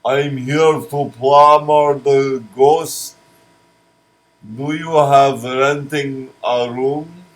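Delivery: a hammed-up foreign plumber from Zoltar; Slogarian accent. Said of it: "I'll do this in a Zoltar, Slogarian accent".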